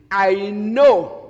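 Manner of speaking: a sudden loud, fed-up burst; loud retort. Acted. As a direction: throw it back at them, exasperated. Elisha snaps at nagging prophets.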